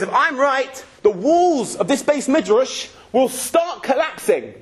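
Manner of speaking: high, slow and grand; lightly acted grand proclamation. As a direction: declare it as a challenge, slowly. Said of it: "He says".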